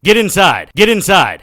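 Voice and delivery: loud, high yelled command; urgent shout. Acted. Harry running from the Seths, shouting at Poppy. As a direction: bark it across the roof.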